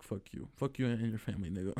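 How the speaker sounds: low, soft retort; muttered back talk. Retold or imitated. Back talking while seeming disrespectful, muttered. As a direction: slip the insult out under your breath. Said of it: "I'm a back talk to you".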